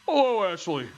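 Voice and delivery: loud, put-on monster greeting; creature voice. Creature greets its captive Ashley, put-on voice. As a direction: boom out a creature's hello. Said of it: "what is that voice I'm giving this thing?".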